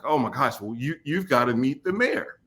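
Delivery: usual speaking voice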